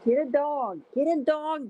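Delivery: high mocking echo of advice; sarcastic quote. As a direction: mimic the people who said get a dog. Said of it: "they said".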